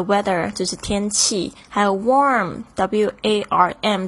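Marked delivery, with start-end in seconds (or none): 2.0-4.1 slow, letter by letter; spelling warm slowly to students. Shown as a demonstration. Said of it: "要特别注意一下它的发音".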